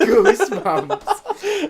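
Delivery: laughing it out high and slow; cracking up on the word. Real, not acted. Friend mocking with laugh.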